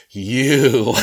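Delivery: a sharp, loud, accusing You; accusing look. Retold or imitated. Family silently blaming the officer, loud accusing. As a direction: blame him in one word. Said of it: "they look at me as if to say".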